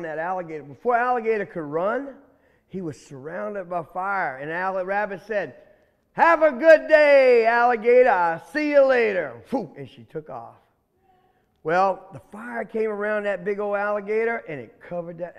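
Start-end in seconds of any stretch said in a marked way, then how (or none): 6.1-9.4 taunting. Acted. Rabbit taunts trapped alligator.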